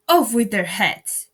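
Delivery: loud, slow furious command; furious order. A narrator's reading. Queen condemns the gardeners, furious loud order. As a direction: order their heads off.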